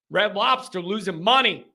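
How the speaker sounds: ordinary talk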